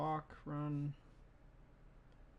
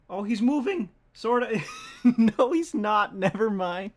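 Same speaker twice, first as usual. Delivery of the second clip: excited shout; 8 semitones higher, 15 dB louder, slower than usual. Real, not acted. Puppet suddenly moves.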